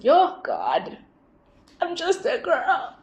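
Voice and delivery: half sob, half laugh; teary giggling cry to the sky; laugh-crying plea. Retold or imitated. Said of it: "I was so emotional".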